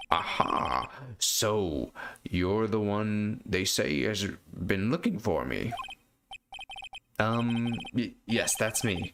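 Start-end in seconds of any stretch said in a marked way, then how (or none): 0.1-5.7 put-on pompous old lawyer. Old lawyer greeting the young visitor, pompous and slow. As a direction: savour every word like a grand old man. Grossberg voiced in game.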